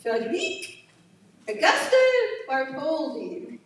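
put-on French accent, high and drawn out. Speaker apologetically tries the sculptor's name for the audience, mock French accent. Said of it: "this is my French accent".

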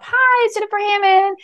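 put-on high, cheerful island-girl greeting; happy voicemail. Shown as a demonstration. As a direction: sing out the greeting with bubbly welcome. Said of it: "my happy voicemail".

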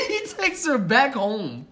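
high, slow, laughing disbelief. Real, not acted. Telling friends he took her back home, laughing. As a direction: tell it through laughter, dragging it out.

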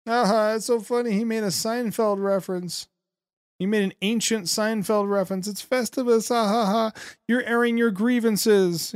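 high-pitched mock delight with a fake laugh; mocking fake amusement. Host mimicking people amused by a Seinfeld reference, mocking.

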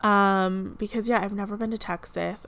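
ordinary delivery

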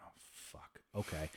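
hushed, deflated realization; chastened. Retold or imitated. Grandpa realizing he let his grandson down, hushed and chastened. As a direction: drop to a near-whisper as it sinks in.

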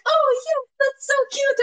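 very high, sing-song mock cute; mocking friend's reply. Mimicking the co-host's cheerful reply, high sing-song. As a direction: chirp it way up high, gushing. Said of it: "And you were like".

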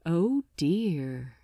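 plain reading voice

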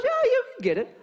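high-pitched put-on encouragement; egging on. Alarm man urges an impossible guess, high-pitched encouragement.

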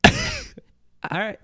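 high burst of laughter; laughing. Real, not acted. Laughs at honest feedback.

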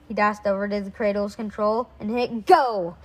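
plain reading voice

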